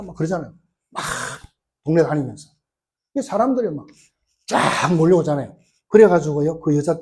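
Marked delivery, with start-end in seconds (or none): none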